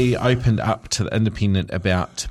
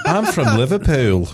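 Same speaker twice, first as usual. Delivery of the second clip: higher, louder mock Liverpool accent, laughing; 4 semitones higher, 5 dB louder than usual. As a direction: put on a playful Scouse accent. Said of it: "is what I heard in my head".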